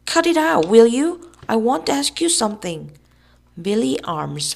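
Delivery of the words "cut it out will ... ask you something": high, loud nervous demand; raised, agitated voice; loud protest. A narrator's reading. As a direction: burst out, trying to stop him. Tom nervously confronts hopping Billy.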